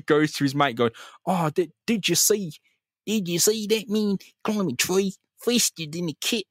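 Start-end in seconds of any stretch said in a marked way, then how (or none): 1.2-6.4 high, strained kid impression gone wrong; cracked-out little kid voice; badly faked child voice. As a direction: pipe up like a child, sounding like a crackhead. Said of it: "That sounds like a crackhead, not a child".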